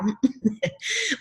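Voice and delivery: short high laugh. Real, not acted. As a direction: laugh at your own story. Laughs after her retort.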